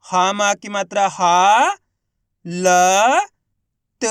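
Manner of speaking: slow spelling with a long हा; isolated sounds with pauses between; letter by letter. Shown as a demonstration. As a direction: hold हा, then ल and त apart. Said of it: "किस तरीके से इनका उच्चारण करके".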